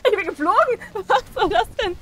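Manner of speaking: outraged surprise at being flung; high-pitched indignant outcry; astonished. Real, not acted. Thrown through the air in game.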